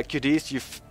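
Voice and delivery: normal talking voice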